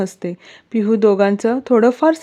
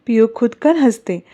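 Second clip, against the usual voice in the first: ordinary delivery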